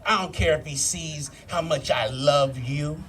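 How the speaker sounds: low, slow, smooth lover's murmur. Retold or imitated. Young dude sweet-talking his girl in the back seat, low and slow. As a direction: purr it like a smooth young lover, lightly voiced. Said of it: "He was all smooth with it".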